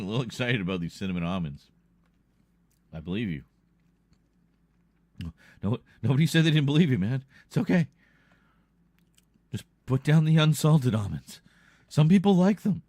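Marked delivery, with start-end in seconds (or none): 5.6-12.8 higher, louder fake calming; high mock-soothing voice. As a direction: soothe him in a shrill mock voice. Mock soothing an excited friend.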